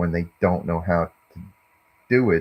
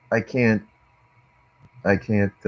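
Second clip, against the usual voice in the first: usual speaking voice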